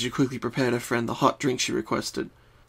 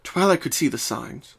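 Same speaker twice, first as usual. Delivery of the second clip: usual speaking voice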